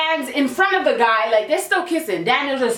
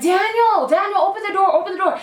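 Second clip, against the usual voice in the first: calling out; 7 semitones higher than usual. Retold or imitated. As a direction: yell at him through the car door. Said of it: "She's like".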